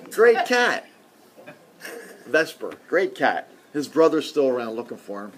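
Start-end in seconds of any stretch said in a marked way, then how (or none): 0.1-0.9 emphatic praise. Real, not acted. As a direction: burst out high and loud on Great. Fond praise of his late cat.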